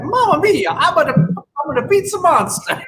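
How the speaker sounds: slow, singsong Italian pizza chef; jolly italian pizza chef. Retold or imitated. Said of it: "They'd be like".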